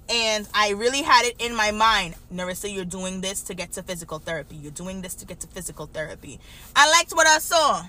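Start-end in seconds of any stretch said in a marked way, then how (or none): 2.3-6.4 low, quick, hushed reminder to yourself; inner self-talk. Retold or imitated. Student reminding herself of her goal, hushed self-talk. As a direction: recite it softly, like a private mantra.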